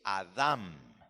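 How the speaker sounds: "Adam" stressed on the last syllable, held M; Hebrew pronunciation. Shown as a demonstration. Teacher contrasting Hebrew with Spanish and English, Hebrew last-syllable stress on "Adam". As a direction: say "Adam" as a-DAM, lengthening the end. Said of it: "en hebreo se dice".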